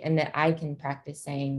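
ordinary delivery

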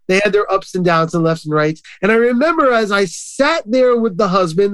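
ordinary talk

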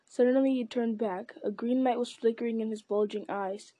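ordinary delivery

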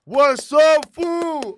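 shouted welcome; loud greeting. Real, not acted. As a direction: yell it to the guest. Greeting the guest.

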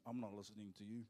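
low, soft stubborn brush-off; mock rebellious refusal, low and soft; mock rebellious sulk. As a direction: mumble it like a sulky rebel. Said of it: "When rebellion kicks in".

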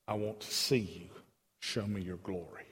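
low, soft, reverent request; quiet, intimate appeal to God; hushed plea. Acted. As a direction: let the voice sink almost away, as in private prayer.

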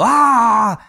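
loud, high yell; sudden drawn-out "aah" scream; imitated shout. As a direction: demonstrate someone screaming right beside you. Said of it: "por ahí le gritan al lado".

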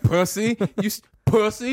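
hammed-up taunt at a coworker; mock taunting yell. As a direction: sneer it out like a bully boss.

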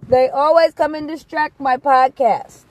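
high, loud and fed up; exasperated loud complaint. Real, not acted. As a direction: complain loudly in frustration. Exasperated by animals.

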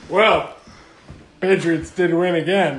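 loud and booming. Pretend. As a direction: boom it out like an announcer. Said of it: "What's with the booming voice".

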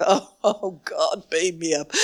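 a hint of weary despair; mock weary plea. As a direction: groan it as if at wits' end. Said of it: "how long am I gonna be with you".

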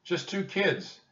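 put-on high, childlike little voice; small voice. A narrator's reading. Annie answering the armed captain, small voice. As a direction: pitch it way up, like a timid child. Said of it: "in a small voice".

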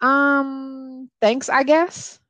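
high, hesitant, drawn-out reply; puzzled, reluctant gratitude; awkward, doubtful thanks. Retold or imitated. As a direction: stretch it out as if unsure it's a compliment. Acting her puzzled reply.

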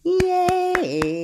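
high, long-held yay; playful cheer. Shown as a demonstration. Librarian celebrating with babies, high drawn-out cheer. Cheer ending the hello game.

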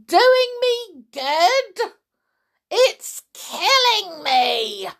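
slow, high, loud screeching; outraged scream. A narrator's reading. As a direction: screech each word out in agony. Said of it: "she screamed".

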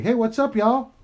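breezy podcast hello, pitched high; casual greeting. Shown as a demonstration. Dad showing a shy daughter how to open, breezy greeting. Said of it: "Just go like".

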